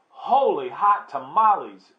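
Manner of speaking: loud, high burst of astonishment; exclaiming. A narrator's reading. Kitty boy sees his reflection, exclaiming loudly. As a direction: cry out at a startling sight. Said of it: "exclaimed Skippy John Jones".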